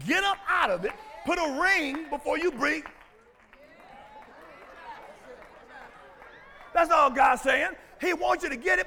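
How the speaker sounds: loud, high fervent preaching; fiery pulpit exhortation; full-force preaching. Acted. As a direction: drive it home to the pews.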